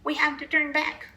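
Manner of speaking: high, loud urgent call; a worried pirate's call. A narrator's reading. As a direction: call over the storm. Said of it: "called another".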